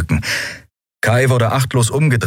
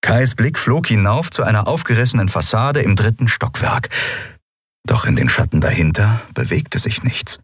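ordinary delivery, nothing marked

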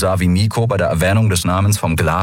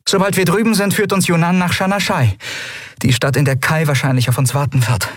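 high-pitched, purposeful leader; rally the crew in a higher voice; Endriel briefing the crew; 10 semitones higher than usual. Acted. Endriel tells the crew it is time, high purposeful voice.

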